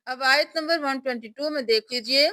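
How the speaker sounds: usual speaking voice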